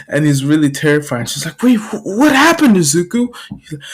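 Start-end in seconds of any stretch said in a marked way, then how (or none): 1.6-3.3 high, stammering concern; alarmed mom. Acted. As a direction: ask anxiously in raised pitch. Alarmed mother questions child.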